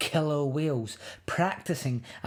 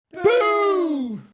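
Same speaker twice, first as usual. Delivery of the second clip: very high, loud shouted boo; 9 dB louder than usual. Acted. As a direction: leap out and yell it. Animals scare the cub.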